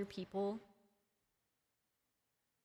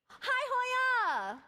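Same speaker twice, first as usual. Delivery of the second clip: excited greeting; 13 semitones higher, 5 dB louder than usual. Real, not acted.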